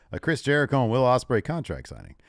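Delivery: ordinary talk, nothing marked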